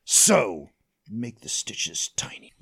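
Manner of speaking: low unvoiced whisper to the doctor; menacing whisper. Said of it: "he's like".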